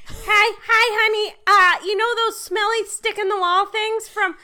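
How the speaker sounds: put-on high, loud Chicago accent mom. Friend imitating mom's Chicago voicemail: high, loud Chicago accent. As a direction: lay on a thick Chicago accent. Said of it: "have you ever heard a more Chicago accent?".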